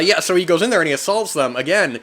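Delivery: ordinary delivery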